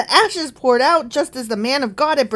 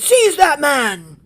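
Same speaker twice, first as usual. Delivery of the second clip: loud, drawn-out royal order; shouted command; 4 semitones higher, 6 dB louder, slower than usual. Acted. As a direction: shout it like a king ordering an arrest. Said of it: "he pointed at him and shouted".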